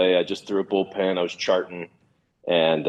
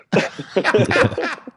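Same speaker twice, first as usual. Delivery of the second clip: real laugh; 13 semitones higher than usual. Real, not acted. Laughs at his own story.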